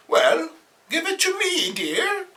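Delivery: very high doting aunt voice; old aunt. Acted. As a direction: coax him gently, dear. Aunt third take.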